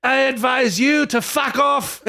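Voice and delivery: high, slow Scottish shout; Scottish accent. Acted. King telling his advisors off, in a shouted Scottish accent. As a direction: yell it in a hammed-up Scottish accent. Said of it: "I gotta do that in a Scottish accent".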